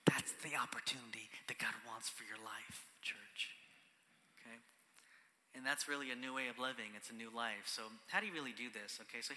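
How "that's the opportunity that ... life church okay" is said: hushed, lower whisper; intimate whisper. Real, not acted. Pastor whispering God's opportunity to the church. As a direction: drop to a whisper to make it land.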